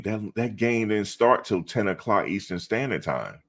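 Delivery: normal talking voice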